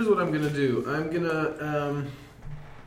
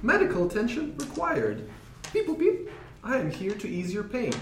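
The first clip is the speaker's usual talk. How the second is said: toy robot voice; 6 semitones higher, 3 dB quieter than usual. Acted. Robot medic speaking in character.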